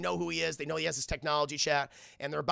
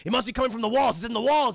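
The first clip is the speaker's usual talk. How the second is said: loud, higher-pitched urgency; acted agitated outburst; frantic insistence; 5 semitones higher, 8 dB louder, faster than usual. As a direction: insist like someone becoming paranoid. Paranoid man insists, acted.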